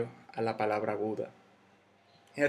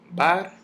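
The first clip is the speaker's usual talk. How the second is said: "bar" as one separate beat, higher and louder; syllable sounded out; 5 semitones higher, 6 dB louder than usual. Shown as a demonstration. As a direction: punch "bar" out as a single syllable. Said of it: "las sílabas son".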